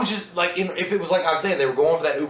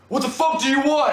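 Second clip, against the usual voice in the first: high-pitched, exasperated challenge to a stalker; acted angry confrontation; 10 semitones higher than usual. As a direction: demand it, pitch jumping well up. Acts out man confronting the stalker.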